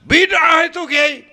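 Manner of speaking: high-pitched mock accusation; accusing friend. Liberal friend challenging the kiai over the drum, mock accusing. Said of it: "Ada lagi teman saya".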